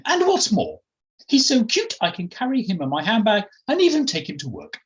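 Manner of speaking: high, swooping genteel voice; delighted posh friend. Acted. Jemima showing off her cute dog to Felicity, delighted. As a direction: gush proudly over the little dog. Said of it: "looked at her with delight".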